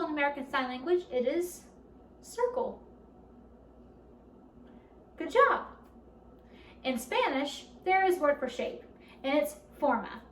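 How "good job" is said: excited praise. Real, not acted. Teacher praising kids.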